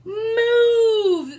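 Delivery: high loud shout of knights. Acted. Knights shouting at Boy to move. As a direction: yell it to clear the way. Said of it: "they shouted".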